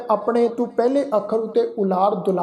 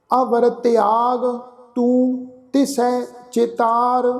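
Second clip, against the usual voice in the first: slow, chant-like Gurbani reading; drawn-out verse with pauses between phrases; scripture recitation; 3 semitones higher, much slower than usual. Shown as a demonstration. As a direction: recite slowly, stretching each line end.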